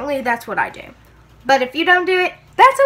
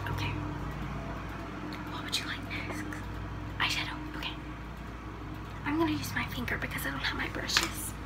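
ASMR whisper; 12 dB quieter, faster than usual. Acted. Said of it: "I have to whisper".

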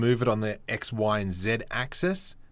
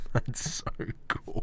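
delighted whisper; 5 dB quieter, much slower than usual. Real, not acted. Delighted with the decal result.

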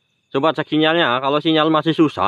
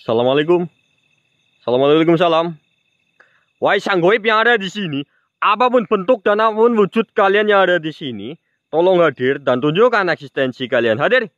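solemn call to the spirits, a bit higher; ritual summoning; 5 semitones higher than usual. Acted. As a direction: address the unseen like a shaman. (memanggil arwah dengan khidmat)

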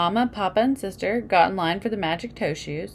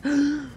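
gasp; 9 semitones higher than usual. Acted. Narrator acting sister's fright.

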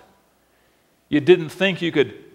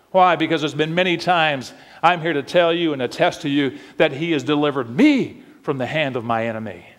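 ordinary delivery

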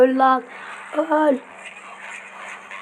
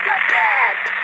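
squeaky shout. Acted. Shouts item name in odd voice.

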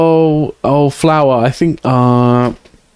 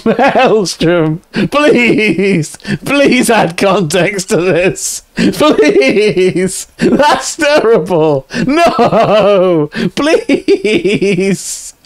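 higher, drawn-out wail through laughter; mortified laughing plea; 5 semitones higher, much slower than usual. Real, not acted. Streamer pleading with chat for context, wailing with laughter. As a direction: beg helplessly while cracking up.

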